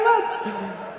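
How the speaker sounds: ordinary delivery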